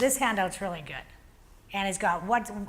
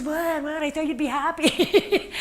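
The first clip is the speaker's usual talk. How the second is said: higher, louder, puzzled and half laughing; baffled coworker; 6 semitones higher, 4 dB louder, slower than usual. Retold or imitated. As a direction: play the confused coworker who expected thanks. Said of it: "He goes".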